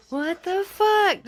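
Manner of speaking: real stunned outburst; shocked. Real, not acted. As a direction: stretch out the shock. Reacting to the door again.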